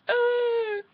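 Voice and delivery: high, sudden fake sob; exaggerated high-pitched crying noise; mock crying wail. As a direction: burst into a hammed-up wail. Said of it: "suddenly she wants to cry".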